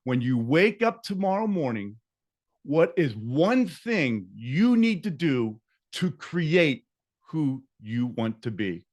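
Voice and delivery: slow, deliberate reading, key words stretched; slow repeat. Shown as a demonstration. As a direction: repeat the question slowly with pauses. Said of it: "slowly and I'll repeat it".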